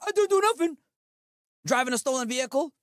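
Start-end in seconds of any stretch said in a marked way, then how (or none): 0.1-0.8 higher, louder mimicked denial; mock protesting suspect. Streamer mocking suspects caught on body cams, mock protest. Mocks suspects denying their crimes.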